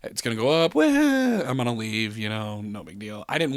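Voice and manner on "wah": long, high "waah"; drawn-out high wail. Retold or imitated. Storyteller mimicking the ride going up, drawn-out wail. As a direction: hold a high sliding cry.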